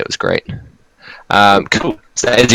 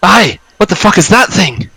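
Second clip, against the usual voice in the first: startled northern Scottish exclamation, much higher; a northern Scottish accent jumping at a spider corpse; startled Scottish cry; 11 semitones higher, 4 dB louder than usual. Acted. GM voices Gundren recoiling from the spider in a northern Scottish accent. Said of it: "his northern Scottish accent".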